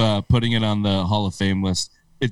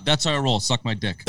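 ordinary delivery

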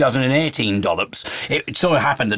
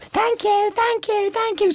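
put-on tiny high-pitched kid voice; squeaky, repetitive singsong child; departing schoolchildren; slower than usual. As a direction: mimic little kids filing past with thanks. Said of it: "all the kids would say goodbye".

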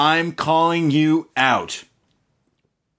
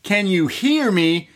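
higher, teasing call; taunting Grimes; 7 semitones higher than usual. Retold or imitated. Grimes teasing Keith Lee, pitched up and taunting. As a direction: poke at him with a sing-song taunt. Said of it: "He says".

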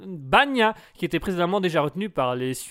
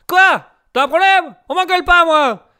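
loud, high angry retort; 9 semitones higher, 11 dB louder than usual. Acted. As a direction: bite back at him. Reporter snaps back angrily.

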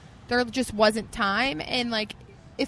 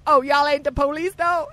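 put-on Southern accent; 5 semitones higher, 3 dB louder than usual. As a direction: play up a Southern accent, teasing. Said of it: "Are you Southern?".

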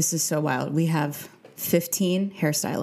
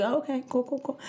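awkward; 8 semitones higher, 4 dB quieter than usual. Retold or imitated. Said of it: "I was like".

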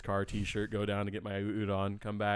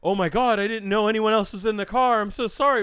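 much higher, louder, over-the-top apology; gushing apologetic valet; 11 semitones higher, 9 dB louder than usual. Retold or imitated. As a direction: gush sorry at the couple from the car. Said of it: "emerged from the car and like".

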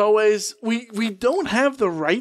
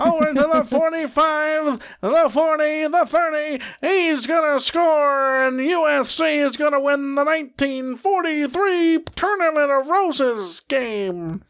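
play-by-play announcer; 6 semitones higher than usual. Pretend. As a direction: call the touchdown like a radio announcer. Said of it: "run through it like a".

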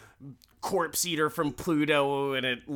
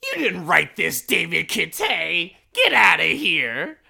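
retold outburst; 4 semitones higher, 8 dB louder than usual. Character snapping at David Kittay.

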